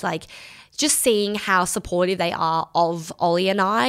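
usual speaking voice

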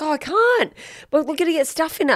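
put-on high, fast little-girl whine; whiny child voice. Daughter whining to mum about walking on her cut toe. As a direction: play the complaining kid, high and quick.

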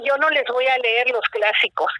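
usual speaking voice

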